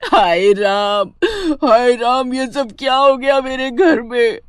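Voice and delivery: loud, drawn-out theatrical lament; wailing mother-in-law. Acted. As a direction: wail over your ruined household. Mother-in-law laments death and injury.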